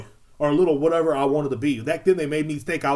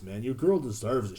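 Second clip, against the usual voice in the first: normal talking voice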